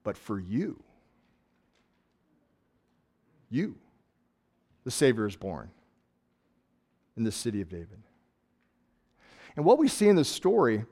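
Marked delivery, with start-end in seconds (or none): 0.0-8.0 low, soft and personal; intimate announcement. Acted. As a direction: hush it to a single listener. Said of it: "turn to one shepherd and go".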